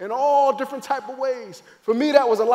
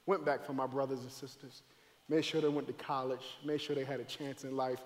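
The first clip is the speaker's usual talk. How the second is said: much softer and lower, almost private; subdued; 8 semitones lower, 14 dB quieter than usual. Real, not acted. Preacher recalling caring for his siblings, hushed and low. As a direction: drop right down and state it quietly. Subdued recounting of family aftermath.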